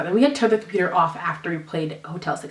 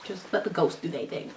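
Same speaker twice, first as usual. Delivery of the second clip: usual speaking voice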